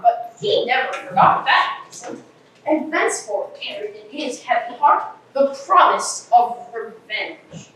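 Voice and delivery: ordinary talk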